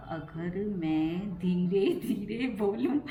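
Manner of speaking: playfully drawn-out slow words; slow talking. Shown as a demonstration. Vlogger wondering how slow speech would sound, slow talking. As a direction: stretch the words out, close to laughing. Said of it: "सोच रही थी मैं थोड़ी practice करूँ धीरे बोलने की".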